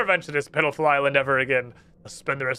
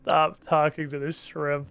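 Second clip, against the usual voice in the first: ordinary talk, nothing marked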